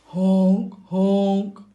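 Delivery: loud, odd honk like a goose; happy honking. A narrator's reading. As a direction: honk it out happily, off-key. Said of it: "the ugly duckling sang happily".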